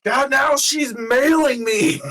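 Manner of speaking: high, very loud, drawn-out comic yell; mock-horrified shout. Player yelling over a villain's package of papers, mock-horrified shout. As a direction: wail it out slowly at full volume. Mock outrage at getting mail.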